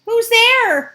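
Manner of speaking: high loud call. Acted. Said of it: "cried the second little pig".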